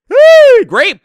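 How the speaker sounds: very high, loud yelp; hype yell. Real, not acted. Cheering a great pass, sudden loud yelp. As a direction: whoop at the play.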